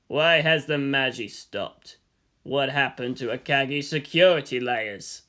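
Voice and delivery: low, slow, cold demand; a commander questioning his staff, slow and low; stern commander. Acted. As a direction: ask it like a grim commander in the dark. Gendo demanding answers.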